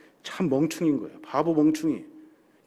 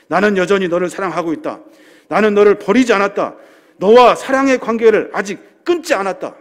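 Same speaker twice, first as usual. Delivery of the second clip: louder, building preached declaration; fervent preaching; 2 semitones higher, 7 dB louder than usual. Acted. Pastor preaching Jesus' words to the congregation, louder and fervent. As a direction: proclaim it with rising fervor.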